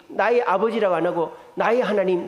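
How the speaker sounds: ordinary talk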